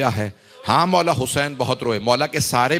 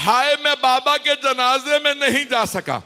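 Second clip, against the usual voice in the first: high, wailing cry of a grieving son; loud weeping lament; 9 semitones higher than usual. Acted. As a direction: wail it like a boy who missed his father's funeral. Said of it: "اونچی اونچی رو کے کہا". (بلند آواز میں روتا ہوا نوحہ)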